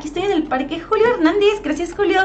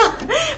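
high giggle; 10 semitones higher, 3 dB quieter than usual. Real, not acted. Laughing at the name.

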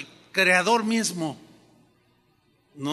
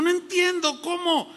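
baffled Ananías; 7 semitones higher than usual. Retold or imitated. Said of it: "Como que dijo".

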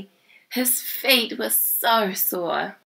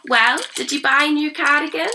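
Grandma; 8 semitones higher, 3 dB louder than usual. A narrator's reading. Grandma voiced in story.

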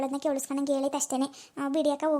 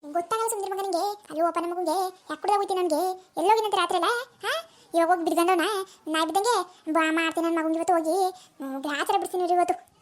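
scolding mother-in-law; 3 semitones higher than usual. Acted. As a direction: scold the late son like a fed-up village mother-in-law. Mother-in-law scolds the absent son.

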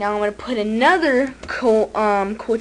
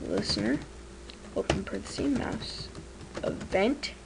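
quiet dictating; 7 dB quieter than usual. Shown as a demonstration. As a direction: murmur each symbol as you type it. Typing code, reading it aloud.

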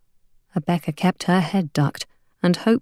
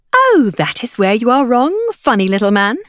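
high, loud, condescending contradiction; hawker woman's retort; 4 semitones higher, 5 dB louder than usual. A narrator's reading. Hawker woman contradicting a doubter, patronising. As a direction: correct him with showy relish.

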